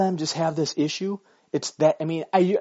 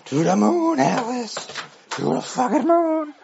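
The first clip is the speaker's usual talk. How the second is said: mock dramatic voice; 9 semitones higher, 5 dB louder than usual. Mock sung line.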